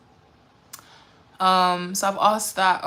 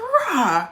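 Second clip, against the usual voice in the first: beast roar; 6 semitones higher, 6 dB louder than usual. Retold or imitated. Imitating the forest beast.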